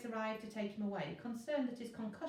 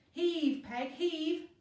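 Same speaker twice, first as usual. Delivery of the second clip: urging shout; 8 semitones higher, 7 dB louder, much slower than usual. Acted. Zeus urging Pegasus on.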